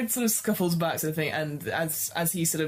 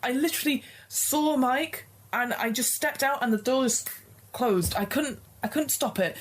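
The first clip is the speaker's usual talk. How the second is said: high distressed voice; 7 semitones higher than usual. Acted.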